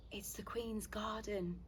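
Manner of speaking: soft, slow, hushed mum; remembered mum's voice. Acted. Daughter recalling mum's words about the wall, hushed and slow. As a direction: say it slowly and softly, like a remembered voice. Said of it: "I remembered my mum's voice saying".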